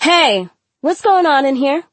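loud, high teacher's shout; roaring. A narrator's reading. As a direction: burst in and demand an answer. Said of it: "Miss Valenti roared".

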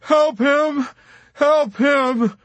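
high, loud, distraught cry; sobbing plea. A narrator's reading. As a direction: beg desperately, close to hysteria. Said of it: "Dobbs was sobbing".

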